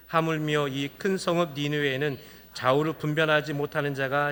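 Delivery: low, measured Bible reading; scripture reading. Acted. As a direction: read the verses steadily to the congregation. Reads scripture aloud. (낮고 차분한 성경 봉독)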